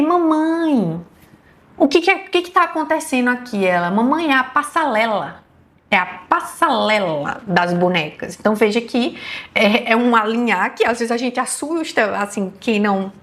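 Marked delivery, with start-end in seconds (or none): 0.0-3.6 a mother puzzled at what her daughter lined up, pitched higher; curious mom, asking her little girl what's going on; mildly voiced mother's question; surprised mother asking. Mother asking child at kitchen. 3.9-8.4 lightly voiced small child, slowing on the second line; a toddler proudly telling mom what she made; a hint of a little girl's voice, lisping the word; little girl explaining. Little daughter answering her mother.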